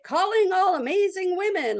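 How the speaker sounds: high, singsong promotional pitch; marketing voice. Acted. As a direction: sell it like an upbeat ad slogan. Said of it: "it took on the marketing voice".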